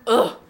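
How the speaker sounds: a loud exasperated ugh; frustrated groan. Real, not acted. Vlogger fed up with her bank card, loud groan. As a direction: groan out your annoyance.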